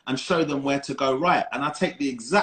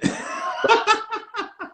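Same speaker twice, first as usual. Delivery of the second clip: burst of laughter. Real, not acted. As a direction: break into a big laugh. Laughs at his own teasing.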